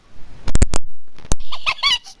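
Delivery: breathless squealing laugh; high shrieking laugh. Real, not acted. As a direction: burst into a shrill laugh. Laughs at own joke.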